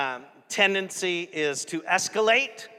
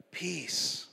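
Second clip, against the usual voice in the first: quiet peace; 7 dB quieter than usual. Retold or imitated. Preacher guessing how Jesus hushed the storm, quiet. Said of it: "I don't know how he said it".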